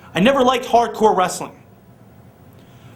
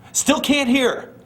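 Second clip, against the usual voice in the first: higher and slow, dragging each word; slow emphatic repeat; 6 semitones higher, slower than usual. Acted. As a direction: stretch it out to hammer it home. Repeats the line slowly, higher.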